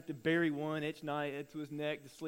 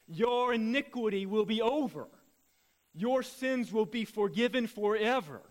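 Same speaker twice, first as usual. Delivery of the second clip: slow, louder rising sermon climax; preaching; 4 semitones higher, 6 dB louder than usual. Acted. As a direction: build it like a preacher at full force.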